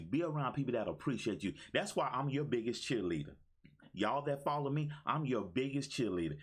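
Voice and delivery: ordinary talk, nothing marked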